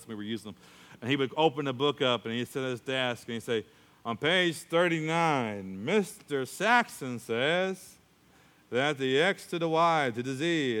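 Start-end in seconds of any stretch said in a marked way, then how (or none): 4.0-10.8 very slow, droning lecture voice; slow monotone math lecture; monotone teacher. Retold or imitated. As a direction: drag each word out like a dull teacher. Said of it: "he had a monotone voice".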